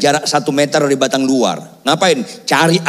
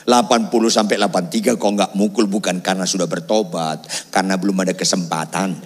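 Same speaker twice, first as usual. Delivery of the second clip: low, level voice of God; God's simple reply; 5 semitones lower, 3 dB quieter than usual. Retold or imitated. God answering the jailed preacher in his heart, low and simple. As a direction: imitate a matter-of-fact inner answer, pitched down. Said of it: "Sederhana Tuhan bicara dalam hati saya".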